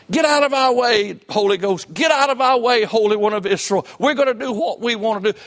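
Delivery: loud, high-pitched cry of defiance; preacher acting out open defiance at full voice; defiant mock outburst. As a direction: shout it out like rebels pushing God aside. Mock defiance addressed to God.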